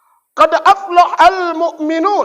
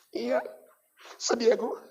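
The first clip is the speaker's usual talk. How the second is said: much softer, sorrowful widower; mopey reply; 5 semitones lower, 16 dB quieter than usual. Acted. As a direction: mumble it like a sad husband. Grieving husband answers. (jawab lirih, sedih)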